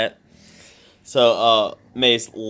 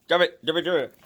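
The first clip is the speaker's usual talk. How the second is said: dog command; 7 semitones higher, 3 dB quieter than usual. Real, not acted.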